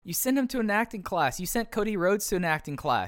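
high, insistent and worked up; worked-up exasperation. Real, not acted. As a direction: argue it emphatically, pitched well up. Riled up over company's hang-up.

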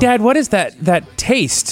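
put-on high, puzzled kid voice; lightly voiced disgusted child; imitating a kid. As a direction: play the picky son. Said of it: "he's like".

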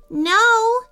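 put-on high, insistent child's "no". A narrator's reading. Jacob contradicts his brother, insistent. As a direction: insist firmly, pitch jumping up. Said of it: "insisted Jacob".

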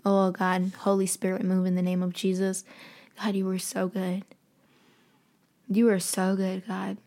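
soft, hushed prayer, fading to a whisper; quiet prayer. Real, not acted. Moved by the verse, a soft hushed prayer to God. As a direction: pray quietly, almost to yourself.

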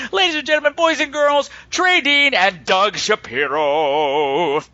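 big showman introduction; grand entrance announcement. Acted. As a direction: announce them like a ringmaster. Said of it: "announcer intro, building".

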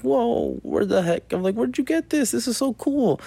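high excited whoa; playing amazed for a kid. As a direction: gush over her toy like a kid would. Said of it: "I was like".

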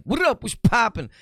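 loud, high-pitched shouted hello; big over-the-top street greeting; hollered greeting. Acted. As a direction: holler out to whoever just came in. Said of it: "people that come in and I'm like".

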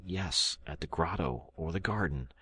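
quietly trying to reassure himself; murmured. Acted. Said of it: "I murmured".

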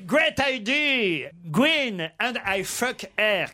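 put-on slow, drawn-out franglais with a thick English accent; mock an English toff stumbling over French; hammed-up English accent. English aristocrat answering his friend's advice, in a hammy English accent. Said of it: "Il prend l'accent de Laurel et Hardy".

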